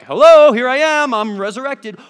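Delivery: hammed-up triumphant greeting; grand announcement. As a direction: announce yourself like a big reveal. Imagined Jesus announcing himself.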